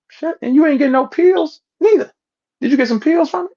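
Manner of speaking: high, loud mocking jeer; high taunting voice mocking a rival. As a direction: taunt him in a high, loud sing-song.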